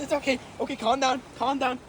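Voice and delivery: soothing a scared dog. Real, not acted. Calming the dog.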